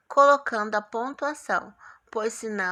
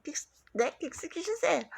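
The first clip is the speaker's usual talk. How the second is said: confused; 5 semitones higher, 4 dB quieter than usual. Retold or imitated. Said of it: "o Lúcio ficou tipo".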